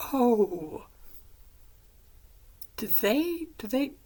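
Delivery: high, loud, stammering questions; startled realization. Retold or imitated. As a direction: blurt it out as it dawns on you. She reacts to secret identity reveal.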